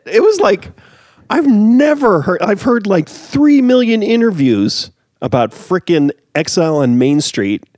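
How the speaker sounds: heated fan rant, real; worked-up rant. Real, not acted. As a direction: vent with rising outrage. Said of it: "I get really emotional about this stuff".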